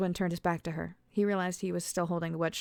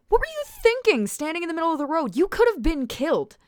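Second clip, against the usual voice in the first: much higher, loud yelling; angry yell; 11 semitones higher, 9 dB louder than usual. A narrator's reading. Alice yells at Oswin for standing in the road. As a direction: tear into him.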